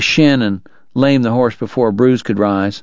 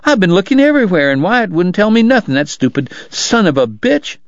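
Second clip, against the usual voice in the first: high-pitched indignant complaint, coming down at the end; demanding; 8 semitones higher than usual. A narrator's reading. Kate to Doc about searching everywhere, indignantly demanding. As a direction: complain crossly in a higher voice. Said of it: "she demanded".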